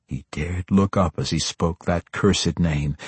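usual speaking voice

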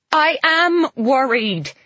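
loud, high, slow outburst on AM; patient shouts at the patronizing doctor. Acted. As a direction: snap back at the doctor. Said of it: "I shout".

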